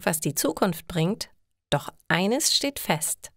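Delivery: normal talking voice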